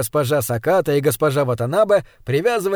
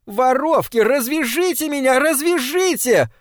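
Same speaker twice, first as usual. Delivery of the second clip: much higher, frantic demand; desperate screaming; 9 semitones higher than usual. Acted. Tied maid screams at the thieving ladies, desperate. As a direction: shriek at the thieves to untie you.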